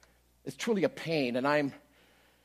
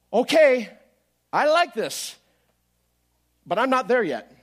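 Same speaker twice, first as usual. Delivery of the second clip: loud, higher declaration of faith; bold declaration; 6 semitones higher, 7 dB louder, slower than usual. Retold or imitated. Man declaring faith over his pain, loud and bold. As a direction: tell the pain he's not there yet.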